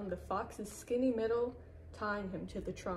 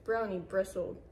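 ordinary delivery, nothing marked

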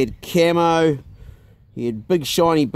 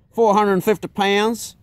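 Texan brag; 6 semitones higher, slower than usual. Retold or imitated. Imitating Chuck.

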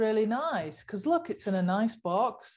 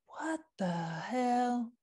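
slow disbelief; 3 semitones higher, 5 dB quieter, much slower than usual. Pretend. To the fashion brands, slow disbelieving what the hell. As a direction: let the disbelief stretch out slowly. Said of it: "I don't know what accent that was".

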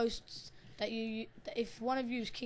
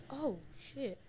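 ordinary talk, nothing marked

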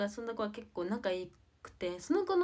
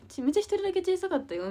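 fast, loud, much higher imitation of mom; a mother insisting her voice was the quietest; emphatic complaint; 11 semitones higher, 10 dB louder, faster than usual. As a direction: rattle off mom's criticism. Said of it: "みたいな言われちゃって".

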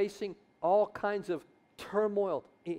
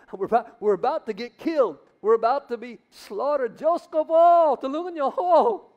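rising, louder, desperate wail; panicked cry to God; 5 semitones higher, 8 dB louder than usual. Pretend. Believers trapped at the Red Sea, crying out in panic. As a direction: beg God in frantic terror.

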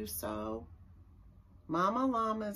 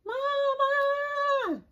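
put-on very high, long child's call to mama; a small child calling downstairs for mama; little llama calling; 4 dB louder than usual. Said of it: "calls down to his llama mama".

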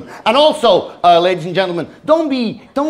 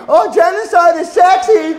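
mocking screaming protesters; 8 semitones higher than usual. Comic mocking protesters screaming on television. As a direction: shriek it like a hysterical protester. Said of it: "then they're screaming".

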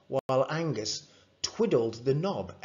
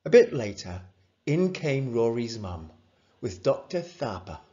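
plain reading voice

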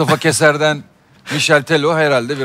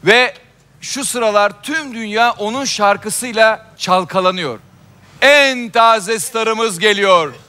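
TV host announcer; 8 semitones higher, much slower than usual. Acted. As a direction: build up the big introduction. Host announces next act.

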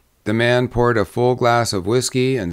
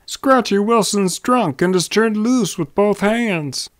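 high, urgent cry of warning; crying out news; 11 semitones higher than usual. A narrator's reading. Young man warning the saloon, crying out. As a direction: call out the alarm, pitched well up. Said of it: "He cried".

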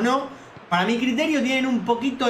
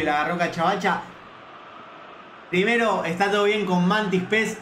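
ordinary talk

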